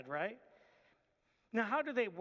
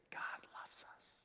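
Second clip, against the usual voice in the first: soft whispered "God loves us"; 11 dB quieter, slower than usual. Shown as a demonstration. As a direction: whisper it like a secret. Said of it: "we might be inclined to whisper that".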